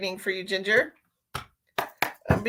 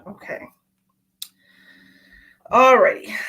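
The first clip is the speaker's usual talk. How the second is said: ordinary talk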